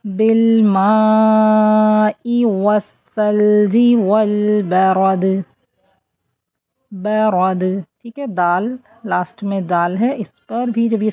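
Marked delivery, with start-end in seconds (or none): 0.0-5.2 بالماء with the ما held four counts; بالماء long madd, then والثلج والبرد. Shown as a demonstration. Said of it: "four count stretch کریں گے".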